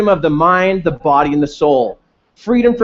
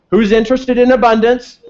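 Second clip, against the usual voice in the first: rallying shout; 6 semitones higher than usual. Real, not acted. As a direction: fire up the crowd with a laugh. Rallying the audience.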